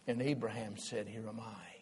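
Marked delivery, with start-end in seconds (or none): 1.1-1.7 humble answer. Acted. Abraham humbly answers God.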